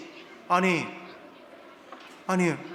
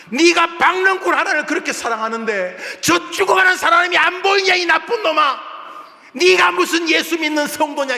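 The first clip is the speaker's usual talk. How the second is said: high, loud furious shouting rebuke; God's roar; 10 semitones higher, 6 dB louder than usual. Acted. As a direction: roar at the disobedient prophet. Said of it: "저건 하나님의 고함입니다". (요나를 꾸짖는 하나님의 높고 큰 고함)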